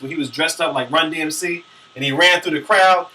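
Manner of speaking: ordinary talk, nothing marked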